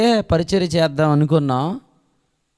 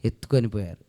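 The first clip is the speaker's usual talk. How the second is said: hushed lament; 5 semitones lower, 3 dB quieter than usual. Acted. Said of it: "సమాధిలో".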